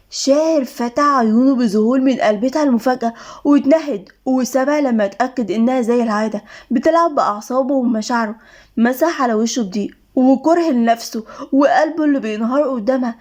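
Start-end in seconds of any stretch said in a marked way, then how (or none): none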